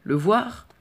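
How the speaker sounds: high, loud scornful echo; mocking disbelief at the boy's excuse; incredulous. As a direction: throw the words back in disbelief. Falconer's incredulous retort.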